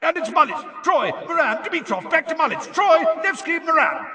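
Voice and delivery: high, loud, breakneck play-by-play; Bagman's screamed commentary. A narrator's reading. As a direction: rattle off names as the ball flies between players. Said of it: "screamed Bagman".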